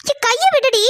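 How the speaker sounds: shrill yell to let go; high protesting yell. Acted. Girl shouting at sister gripping her arm, high yell. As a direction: pull free and shout high.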